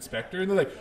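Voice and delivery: normal talking voice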